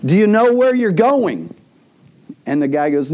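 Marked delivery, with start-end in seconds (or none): 0.0-1.5 high-pitched mock yell at the driver. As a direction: passenger hollering at his cab driver, mildly. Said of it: "So he yells at the driver".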